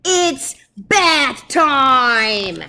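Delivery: loud, slow, menacing squeak; tiny mouse acting tough; tough-guy threat. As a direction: draw it out like an action hero. Big Cheese threatens Dogzilla.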